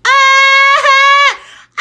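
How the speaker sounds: high loud shriek of delight; excited scream. Real, not acted. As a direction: scream with thrill. Screaming at colourful pearls.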